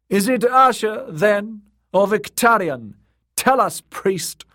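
high, loud demanding voice; high-pitched, forceful questioning; challenging lord. Acted. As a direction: demand an answer from the priest. Lord challenges the priest loudly.

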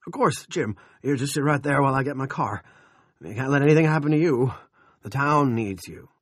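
high, loud, anxious fussing; alarmed. A narrator's reading. Al to ailing Jim before getting the car, alarmed. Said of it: "looked more alarmed than ever".

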